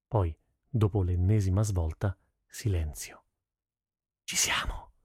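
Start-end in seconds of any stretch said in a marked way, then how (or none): 4.2-4.9 hushed, unvoiced whisper; excited secret whisper before the attack; breathless whisper. A narrator's reading. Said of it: "bisbigliò Nihal col fiato mozzo".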